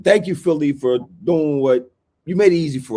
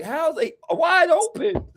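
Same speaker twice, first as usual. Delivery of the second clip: mocking confused opponents. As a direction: whine like a player who can't believe it. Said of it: "y'all like".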